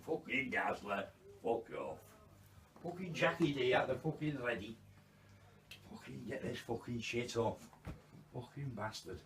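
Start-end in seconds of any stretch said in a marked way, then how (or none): none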